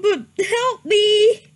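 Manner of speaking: normal talking voice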